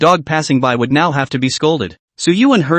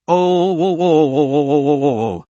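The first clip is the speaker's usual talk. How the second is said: sung-out wailing sobs, dropping away at the end; sobbing wail. Acted. Crying sister wailing at her brother, sobbing wail. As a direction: wail it in a falling sob.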